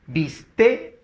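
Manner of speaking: Viste split as bis-TÉ, rising on TÉ; stress on the last syllable. Shown as a demonstration. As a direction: sound out Viste in two parts, punching TÉ. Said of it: "aguda que termina en vocal". (Viste silabeado como bis-TÉ, con fuerza al final)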